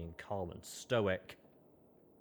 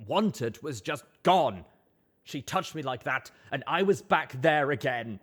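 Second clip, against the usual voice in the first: distraught; 7 semitones higher, 9 dB louder than usual. Acted. Distraught confession.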